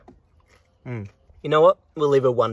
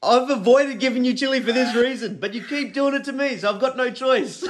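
mocking taunt; 12 semitones higher than usual. Real, not acted. Taunting her over the chili.

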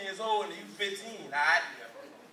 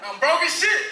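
loud laughing shout, higher; 6 semitones higher, 7 dB louder than usual. Real, not acted. Comic to crowd, a laughing shout.

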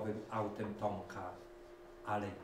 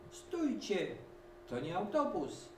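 much higher call to halt; stopping passengers; 9 semitones higher than usual. A narrator's reading. Dog Reksio stopping the passengers, high call. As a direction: try to stop the crowd. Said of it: "próbował powstrzymać pasażerów Reksio".

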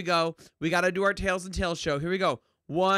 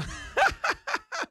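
high giggle. Real, not acted. Filter laugh.

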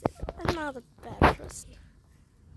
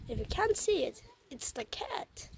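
ordinary talk, nothing marked

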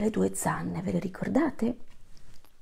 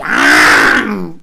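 loud open-mouthed lion roar; 13 dB louder than usual. Acted. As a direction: let out a big roar. Lion king roars.

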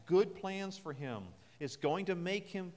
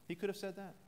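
usual speaking voice

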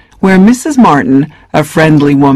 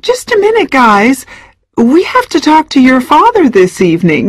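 higher, firm motherly tone; calm the kids down with authority; mother's voice; 10 semitones higher than usual. Acted. Mom halts the kids' excitement, firm.

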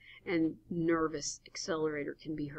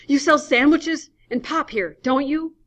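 high, loud and out of breath; breathless; 9 semitones higher, 13 dB louder than usual. Acted. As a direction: gasp the question out. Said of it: "Adams, breathless".

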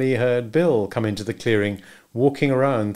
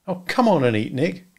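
put-on higher, lightly coaxing young woman; Marjorie coaxing; 5 semitones higher than usual. A narrator's reading. Marjorie urging a moody Nick to eat, coaxing. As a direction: coax him to eat.

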